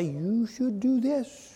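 a quiet conscience urging you on; little inner voice. Retold or imitated. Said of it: "heard that little voice say".

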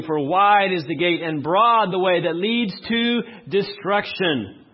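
slow, weighty reading of the verse; scripture reading. Acted. Preacher reading the verse slowly to the congregation. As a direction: lay each word down slowly.

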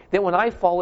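normal talking voice

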